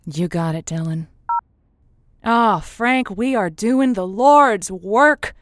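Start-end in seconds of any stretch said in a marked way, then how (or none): 2.2-5.4 loud, slow, grand declaration; triumphant proclamation. Acted. Host boasting to her producer after solving the call, loud and slow. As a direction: proclaim it like a victory sermon.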